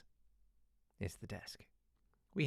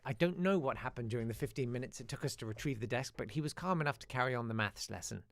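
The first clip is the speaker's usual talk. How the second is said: ordinary delivery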